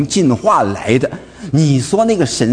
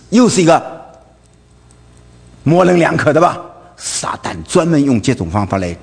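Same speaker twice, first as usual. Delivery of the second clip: ordinary talk, nothing marked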